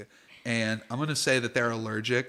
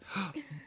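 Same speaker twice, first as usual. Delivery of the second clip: startled gasp; 8 semitones higher, 11 dB quieter than usual. Acted. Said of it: "one of the bees says".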